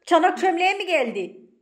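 loud, high, insistent telling-off; exasperated scolding. Real, not acted. Owner telling housemate to ask before painting, exasperated scolding.

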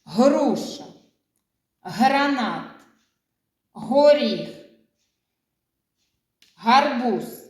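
each word set apart, first sound Г lifted; Груша, гранат, горіх, гарбуз, each Г leaned on with a pause between words; stress on the first sound. Shown as a demonstration. Teacher to children, making them hear the first sound. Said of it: "Послухайте уважно".

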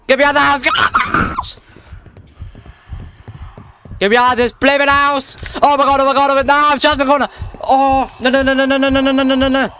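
very high frantic shrieks; desperate, high-pitched terror; panicked screaming. Real, not acted. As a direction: scream to be let out, spiralling into rapid babble. Screaming while fleeing the monster.

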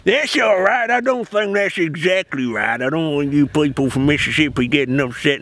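apologizing to Mississippi viewers in a mock Southern accent. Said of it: "trying my best to do your accent".

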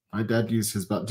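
usual speaking voice

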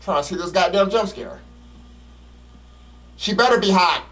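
ordinary talk, nothing marked

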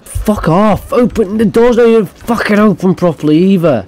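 high, fast furious rant; high angry outburst. Real, not acted. Gamer yelling at a door that won't open, high fast rage. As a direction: explode at the stuck door.

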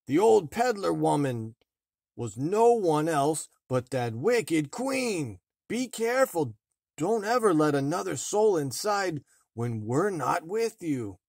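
dwarf voice, anxious; worried dwarves. A narrator's reading. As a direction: protective dwarves urging care.

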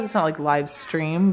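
usual speaking voice

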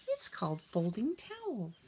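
cheerful trick, chores as game; sly sell. A narrator's reading. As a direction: sell the boring game brightly.

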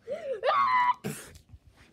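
high, loud crying gasp; shocked gasp. Acted. Friend sees the cookie, high crying gasp. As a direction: gasp in horror.

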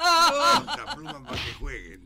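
slow, loud, laughing through it; can't stop giggling; laughing through the punchline. Real, not acted. Teller laughing mid-quote.

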